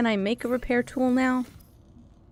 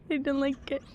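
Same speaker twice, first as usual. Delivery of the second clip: scared murmur; 6 dB quieter than usual. Real, not acted. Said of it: "I have a fear".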